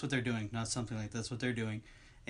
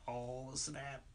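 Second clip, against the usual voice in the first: under-the-breath reaction; 12 dB quieter, slower than usual. Retold or imitated. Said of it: "I was like".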